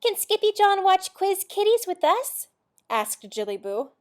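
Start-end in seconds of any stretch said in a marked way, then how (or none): 0.0-2.5 high, loud little-sister voice; little kitten's plea. A narrator's reading. Little sister asking Mama about TV, high childlike plea. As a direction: ask eagerly, pitched way up.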